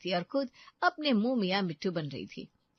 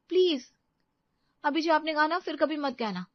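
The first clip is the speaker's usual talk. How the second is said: higher, urgent plea from a wife; pleading protest; 9 semitones higher, 4 dB louder than usual. Acted. Wife hushing her husband's talk of not returning, pleading protest. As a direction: cut him off with a raised-pitch please.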